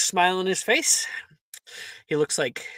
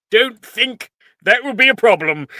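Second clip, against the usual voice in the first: amused Sorting Hat; 7 semitones higher, 9 dB louder than usual. Acted.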